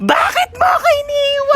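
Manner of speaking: mock wailing. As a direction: push the fake sob further up.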